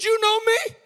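high, rising astonished question; startled disbelief. Retold or imitated. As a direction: squeak it out in mock astonishment. His startled reply to the soldier.

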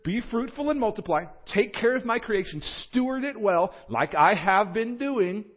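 high, loud, emphatic charge; God. Acted. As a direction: deliver a rousing commission, high and forceful. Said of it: "This is actually God saying".

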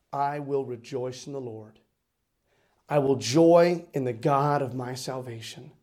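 low, slow, solemn pulpit declaration; measured, reverent preacher's reading; scripture recitation. Acted. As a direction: declaim each phrase with weight and pauses.

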